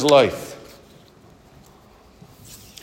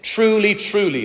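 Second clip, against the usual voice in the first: "Truly, truly" lifted much higher, each word weighted; emphatic; 8 semitones higher than usual. Acted. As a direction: land hard on each "Truly". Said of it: "this emphatic way of saying".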